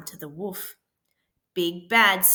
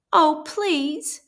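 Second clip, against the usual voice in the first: high, louder sniffly begging; sniffling plea; 7 semitones higher, 6 dB louder than usual. A narrator's reading. Sick wolf begs the pig for a tissue, sniffling. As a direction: beg through a runny nose. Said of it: "sniffled Big Bad".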